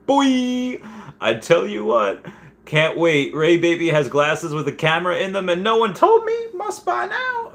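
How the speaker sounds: high, hammed-up eagerness rising to a squeak; mock hype. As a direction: oversell it like a gadget fanatic. Mock eager reaction to AR glasses.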